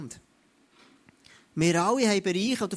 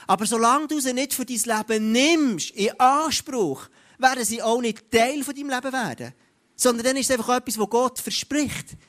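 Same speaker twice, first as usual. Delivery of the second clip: preaching at full force; 4 semitones higher than usual. Acted. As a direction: drive the point home to the congregation. (Predigt mit voller Kraft, höher und lauter)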